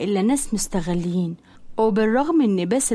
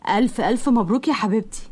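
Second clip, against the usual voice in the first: plain reading voice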